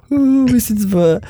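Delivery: high, drawn-out wail of helplessness; retold panicked dismay. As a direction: imitate a flustered sister, pitched high and slow. Retells sister waking beside wet mattress. (återberättad, hjälplöst gnällande förtvivlan)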